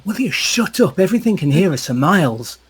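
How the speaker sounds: high, loud exasperated scolding; scolding the dog. Acted. Marazzo scolding his yapping lapdog, high and loud. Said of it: "He's going".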